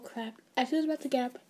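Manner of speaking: usual speaking voice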